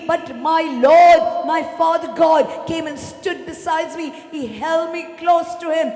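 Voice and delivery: impassioned, shouted pulpit witness; full-force preaching. Real, not acted. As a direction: pour out the testimony with fervour.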